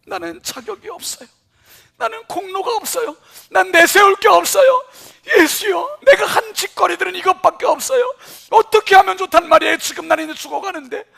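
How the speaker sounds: loud, high crying plea; the thief begging Jesus, crying; anguished plea. Pretend. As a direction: sob it out in despair.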